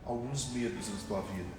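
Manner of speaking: soft, low, slow solemn word; voice of God. Retold or imitated. God speaking to him at the pulpit, soft and solemn. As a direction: hush it like a holy revelation.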